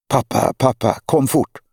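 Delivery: put-on boy's high, urgent shout to his father; excited call for help. Boy on the jetty calling his father, excited. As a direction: call Dad over, hooked on a big fish.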